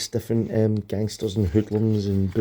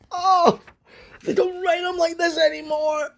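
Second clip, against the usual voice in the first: much higher, gushing fan delight. Real, not acted. A fan gushing over an old comic, delighted and high. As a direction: squeal with nostalgic joy.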